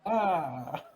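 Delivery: normal talking voice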